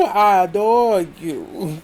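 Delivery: slow, put-on adore; mocking drawl. Mocking her fake apology to Lisa with a slow adore. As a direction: stretch adore out slowly, mocking.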